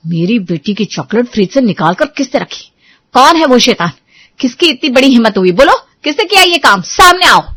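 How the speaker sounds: mother yells at the household over the chocolate; furious yell. Acted. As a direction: build from demand to full enraged shout. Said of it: "उसने जोर से चीखना शुरू कर दिया".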